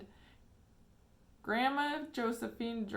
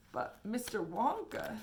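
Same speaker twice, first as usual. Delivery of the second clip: put-on stammering old man; 3 semitones higher than usual. A narrator's reading. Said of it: "stammered Grandpa Joe".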